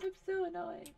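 mild whining annoyance; annoyed whine. Real, not acted. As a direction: grumble it with a sigh.

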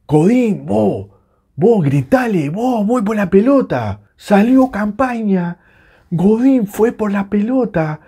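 exasperated, high-pitched yelling at the players; reproche exasperado. Real, not acted. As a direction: scold the defender from the couch, pitched high. Rants at players after blunder. (reproche a los gritos, agudo)

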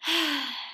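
weary sigh; soft sigh. A narrator's reading. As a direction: let out a tired sigh. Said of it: "Missus Bear sighed".

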